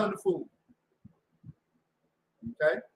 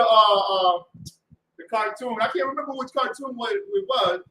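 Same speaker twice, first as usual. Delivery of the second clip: ordinary delivery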